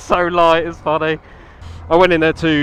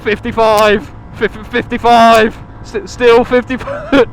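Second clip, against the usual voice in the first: mock racing shout; 7 semitones higher, 5 dB louder, slower than usual. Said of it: "We would be like".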